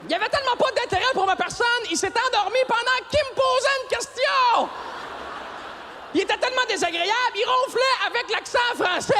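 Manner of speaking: crié haut, outré; s'indigner à pleine voix; indignation exagérée. Acted. (humoriste outré que l'albinos s'endorme, crié aigu)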